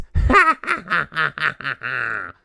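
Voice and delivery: loud gloating villain cackle; evil cackle. Acted. Malvado cackles again at the little fool. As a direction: laugh her to scorn.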